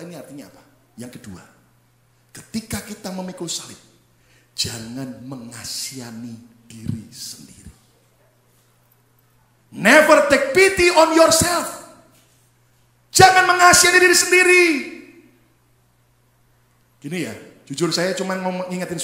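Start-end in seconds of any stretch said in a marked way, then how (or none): 4.5-7.7 slow, low, near-whisper; soft, drawn-out solemn hush; hushed warning. Shown as a demonstration. Point said hushed before shouting it. 9.7-11.8 slow, high, very loud proclamation; thundering pulpit command; forceful exhortation. Real, not acted. Point repeated in English, full force. 13.1-14.6 high, very loud preacher's shout; full-force pulpit command; shouted exhortation. Shown as a demonstration. Same point repeated as a shout.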